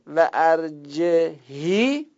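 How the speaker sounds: ارجهی stretched out very slowly; teacher slowly models ارجهی; slow model reading. Shown as a demonstration. Naming the reading, slowly. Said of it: "قرائت که میگه".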